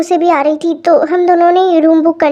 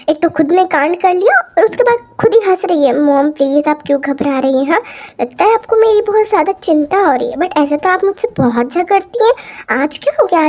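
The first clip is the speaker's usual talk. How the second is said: plain reading voice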